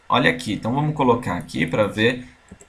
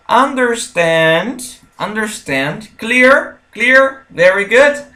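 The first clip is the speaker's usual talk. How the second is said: excited praise; 7 semitones higher, 3 dB louder, slower than usual. Acted. Professor elogia o aluno animado.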